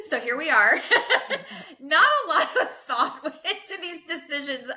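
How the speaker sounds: laughing at herself. Real, not acted. Confessing to the host how little thought went in, laughing. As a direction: giggle through a self-mocking admission. Laughing at her own impulsiveness.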